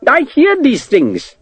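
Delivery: slow, high protest from a meek clerk. Acted. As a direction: insist timidly, drawn out. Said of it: "the clerk protested".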